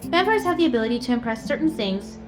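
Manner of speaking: normal talking voice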